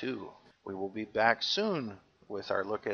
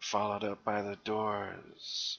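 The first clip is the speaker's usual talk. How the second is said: seventies FM DJ; 4 semitones lower than usual. Retold or imitated. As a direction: ease into it like a late-night FM host. Continues FM DJ bit.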